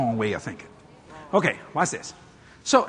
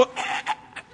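choked gasps; 9 dB quieter than usual. Retold or imitated. Dealer choking under elbow.

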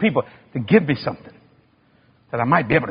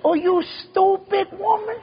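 very high, slow, comic outrage; mock exasperation. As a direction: squeal it at her in disbelief, drawn out. Acted quarrel with wife.

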